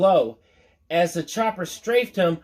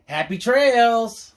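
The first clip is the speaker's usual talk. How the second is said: slow, high and louder sendoff; mocking farewell; 6 semitones higher, 4 dB louder, slower than usual. A narrator's reading. John sending the villain off, a slow loud mocking farewell. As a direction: drawl it out like a cowboy's goodbye.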